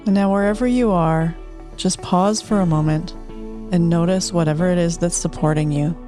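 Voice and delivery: unhurried soothing relaxation guide. Acted. Host leads closing guided meditation.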